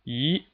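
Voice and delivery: E rising steadily; second tone. Shown as a demonstration. Teacher shows learners the second tone, steadily rising E. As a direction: slide E upward with no dip at all. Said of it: "rising all the way up steadily".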